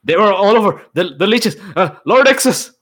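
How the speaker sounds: high panicked yells from a nightmare; sleep yelling. Acted. Bidrohi crying out in his sleep about leeches, panicked yell. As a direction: cry out in terror half asleep.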